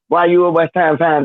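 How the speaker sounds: normal talking voice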